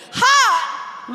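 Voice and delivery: loud call to the crowd; cheer shout. Real, not acted. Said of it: "scream at the top of your voice".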